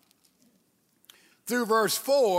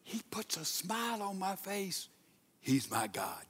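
hushed recitation; 10 dB quieter than usual. Shown as a demonstration. Preacher showing church how to say it, hushed. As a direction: say it hushed, almost to yourself. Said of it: "Now let's say it like this".

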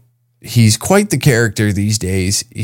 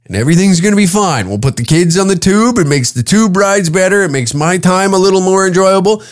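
put-on higher-pitched, upbeat holiday pitch to the kids; parent's sales pitch; 7 semitones higher than usual. Dad selling Kelowna to the kids as Disneyland, upbeat pitch. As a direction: sell it like a chipper parent.

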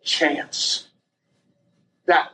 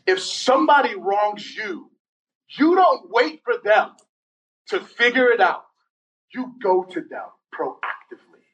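preaching paraphrase; 5 semitones higher than usual. Acted. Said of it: "He says this".

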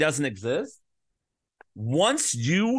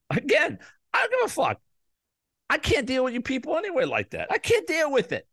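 fed-up rant; 9 semitones higher, faster than usual. Real, not acted. Declares he can't deal with listeners.

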